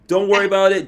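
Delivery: ordinary talk, nothing marked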